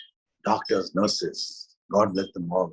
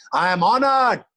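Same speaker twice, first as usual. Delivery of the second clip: louder, higher declaration of thanks; proclaiming; 13 semitones higher, 9 dB louder than usual. Acted. Teacher proclaiming gratitude to the gods, loud. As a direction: announce it with pride.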